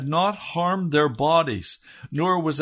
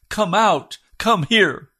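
loud, higher royal summons; shouting king; 6 semitones higher, 6 dB louder, slower than usual. Acted. King shouting into the blazing furnace. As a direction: call them out of the furnace with full force. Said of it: "and he shouts".

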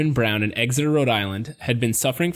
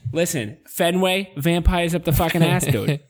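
hammed-up Boston accent, a bit higher; 4 semitones higher than usual. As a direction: rant like a mock Boston local. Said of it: "the Boston accent that we can do so well".

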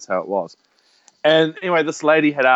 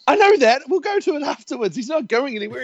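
mock exasperated protest; 11 semitones higher than usual. Son protesting to mum about the crematorium, mock exasperation. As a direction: argue back like an overwrought teen.

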